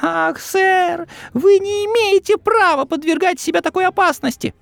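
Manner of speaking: high, squeaky little elf voice; house-elf voice. Acted. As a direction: speak as a nervous, eager house-elf. Dobby pleading.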